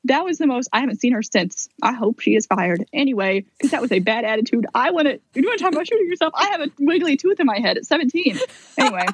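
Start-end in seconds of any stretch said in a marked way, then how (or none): 4.7-8.4 high-pitched, indignant retort; mock outrage. Patient snaps back at the hygienist in her retelling, indignant. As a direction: replay the anger, pitch jumping up. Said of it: "God, she made me mad".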